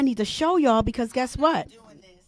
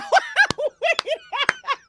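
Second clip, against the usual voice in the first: real shrieking laugh much higher; high delighted laugh; 4 dB quieter than usual. Real, not acted. Laughing at own remark.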